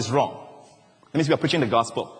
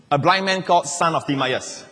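usual speaking voice

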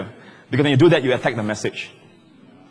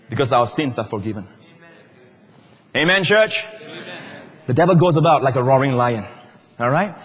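ordinary talk

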